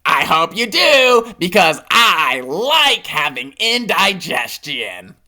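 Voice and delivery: slow, pleased monster voice; smiling monster. Acted. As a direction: grin through the words.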